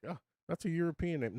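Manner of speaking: lightly mocking, high and quick; mock enthusiasm. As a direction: imitate someone taken with all things European. Mimics wife's fondness for European things.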